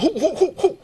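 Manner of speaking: high, repeated startled who; playful who-who; mock surprise. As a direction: act baffled and suspicious. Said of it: "the sister was like".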